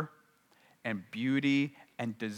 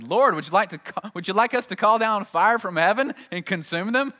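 eager disciples; 10 semitones higher, 8 dB louder than usual. Retold or imitated. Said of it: "disciples came up to Jesus and said".

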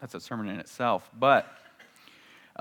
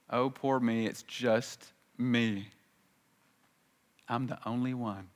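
softer hammed-up poor-me lament; mock self-pity; 6 dB quieter than usual. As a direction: sulk in the cave feeling sorry for yourself. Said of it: "he has a pity party".

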